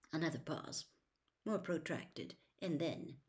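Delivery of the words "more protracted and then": plain reading voice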